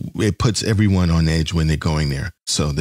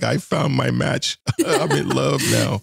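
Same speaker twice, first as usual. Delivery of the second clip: higher, slow, laughing lovestruck gush; giddy mock lovestruck; 6 semitones higher than usual. Star Trek fan finds a Klingon-speaking girl, laughing giddily. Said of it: "It's like".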